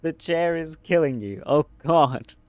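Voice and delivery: loud, high yelp of dread; real panic. Real, not acted. Reacting to the chair killing the character, loud and high. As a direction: squirm and cry out.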